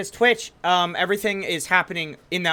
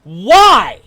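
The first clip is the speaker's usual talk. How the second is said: high, loud screamed outburst; mock anguished yelling, far above usual pitch; imitated distraught screaming; 8 semitones higher, 11 dB louder, much slower than usual. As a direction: scream it out like a streamer breaking down. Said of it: "they're crying and they're screaming".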